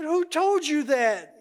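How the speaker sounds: incredulous demand. Retold or imitated. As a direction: draw it out in disbelief. Ron demands the source.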